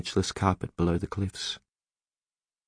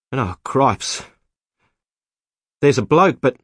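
louder, higher startled voice; shocked; 6 semitones higher, 8 dB louder than usual. A narrator's reading. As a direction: blurt it out in alarm. Man shocked at finding a body.

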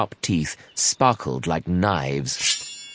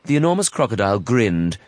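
plain reading voice

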